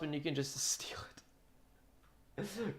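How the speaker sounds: hushed, joking whisper; conspiratorial whisper. Acted. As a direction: whisper it like a sly secret. Joking about stealing the drums.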